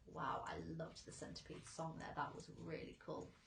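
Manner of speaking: soft unvoiced whisper. Real, not acted. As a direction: breathe it out quietly. After-reading remark, whispered.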